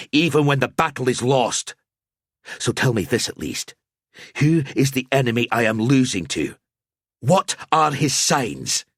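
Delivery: rally like a warrior; defiant knight. A narrator's reading. Gruber swears to fight on before Al-Azir, proud and loud.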